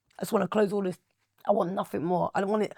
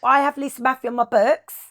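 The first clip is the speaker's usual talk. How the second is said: higher, louder, chirpy Cockney sales pitch in a put-on voice; fake Cockney accent; 5 semitones higher, 6 dB louder than usual. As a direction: play a Cockney booking agent selling her act on the phone. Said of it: "I used to put a really Cockney accent on".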